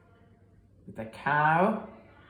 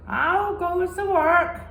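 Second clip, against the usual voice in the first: calling farmer; 12 semitones higher, 6 dB louder than usual. Acted. Farmer calls again.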